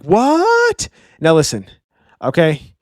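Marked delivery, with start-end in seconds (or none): 0.0-0.9 sudden squeak of disbelief, much higher; stunned what. Retold or imitated. Road shocked to hear Gerd respects him. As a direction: yelp it, can't believe it. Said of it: "Road is just like".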